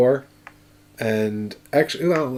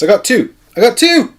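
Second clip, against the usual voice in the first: excited; 13 semitones higher, 10 dB louder than usual. Real, not acted. Rolling dice for a big heal.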